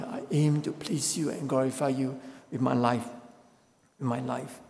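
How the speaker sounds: usual speaking voice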